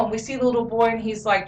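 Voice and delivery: normal talking voice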